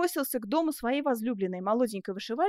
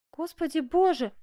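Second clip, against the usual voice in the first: higher, dismayed cry; shocked exclamation; 6 semitones higher, 3 dB louder than usual. Acted. Seamstress learns of the youth's arrest, shocked. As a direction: gasp out the words in dismay. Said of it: "воскликнула Жервеза".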